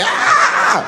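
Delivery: very high loud scream of disgust; shocked scream. Acted. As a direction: shriek as it hits you. Victim hit.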